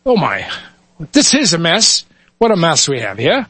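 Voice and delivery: Irish brogue of a cop surveying a crash. Acted. Irish officer Muldoon looks at the wreck, mild Irish accent. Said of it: "switch between dialects here".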